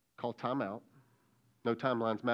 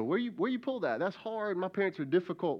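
mock kid protest, pitched higher; whiny kid; 7 semitones higher than usual. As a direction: play the kid pushing back, whining. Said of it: "You go".

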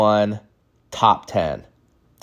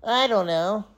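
put-on high, soft little-kid voice; put-on child voice; clueless kid; 10 semitones higher, 6 dB quieter than usual. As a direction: answer small and high like a child. Child answers the teacher's hard question.

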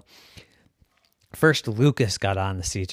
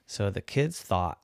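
plain reading voice

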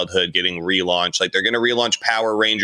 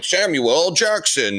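higher-pitched mock celebrity announcement; hyped name-drop; 7 semitones higher, slower than usual. Host mimicking studios touting celebrity casting, hyped name-drop.

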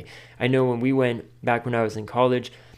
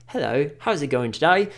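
playful mock British accent, a bit higher; 4 semitones higher than usual. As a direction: put on a cheery British accent as a stranger. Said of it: "British person".

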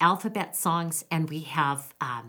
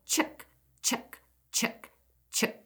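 soft chicken call, higher; 4 semitones higher, 4 dB quieter, slower than usual. Shown as a demonstration. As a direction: call the hens gently. Calling chickens in the rhyme.

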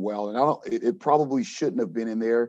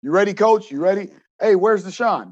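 higher, louder call to another coach; 6 semitones higher, 5 dB louder than usual. Retold or imitated. Coach checking everyone is ready to drive, calling out. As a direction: call across to the other van.

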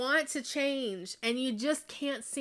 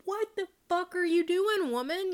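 panicked ego; 8 semitones higher than usual. Retold or imitated. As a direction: blurt it out like a panicking inner voice. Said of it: "My ego is like".

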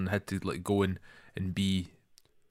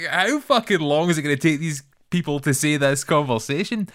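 louder, higher mock exasperation; exasperated; 5 semitones higher, 5 dB louder than usual. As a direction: complain in a hammed-up way. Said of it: "how I've just felt like every single person was talking".

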